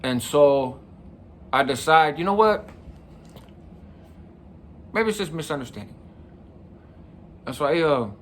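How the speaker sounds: normal talking voice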